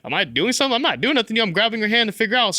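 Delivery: high-pitched defensive protest; exasperated, retold self-defence; retold frustrated protest. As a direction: protest your innocence, pitch jumping up. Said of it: "I'm getting frustrated".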